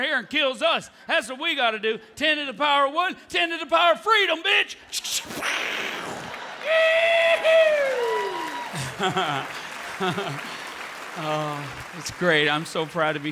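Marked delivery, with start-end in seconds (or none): 2.2-4.8 high, loud, fast war cry; patriotic shout. Acted. Comic as American fan, shouting a patriotic finish. 4.8-11.1 long maniacal laugh. Acted. Huge mock cackle.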